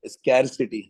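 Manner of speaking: स्कैरसिटी low, with affected "skair"; twisted-mouth pronunciation. Shown as a demonstration. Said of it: "थोड़ा मुंह टेढ़ा करके बोलना पड़ेगा".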